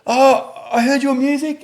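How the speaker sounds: high-pitched mock child voice; imitated school kid. Dad imitating his kids' friends, high kid voice. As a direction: put on a kid's high little voice.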